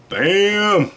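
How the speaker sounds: loud, high reveal shout; excited. Real, not acted. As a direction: announce it with a bang. Reveals the poker chip.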